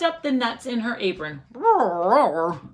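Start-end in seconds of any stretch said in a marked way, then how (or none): 1.5-2.6 bear roar. Acted. Bear losing at dreidel, a loud playful roar. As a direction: roar like a cross storybook bear. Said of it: "Old Bear roared".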